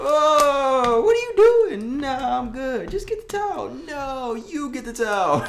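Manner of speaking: high-pitched, hammed-up squabble; mock panicked argument. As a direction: wail both sides in comic alarm. Acts out panicked bedroom argument.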